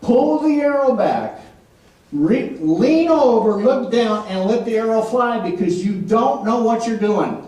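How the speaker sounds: slow, deliberate instructions; measured divine command. Retold or imitated. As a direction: give each order slowly and firmly. Acting out God's slow instructions.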